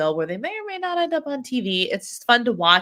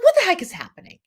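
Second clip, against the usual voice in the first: high, loud baffled outburst; mock exasperated what-the-heck; 10 semitones higher, 6 dB louder, faster than usual. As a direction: throw it out in disbelief. Exasperated self quote.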